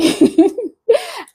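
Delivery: squealing laugh, high and sudden; high burst of laughter. Real, not acted. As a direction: crack up at the name. Reacting to odd username.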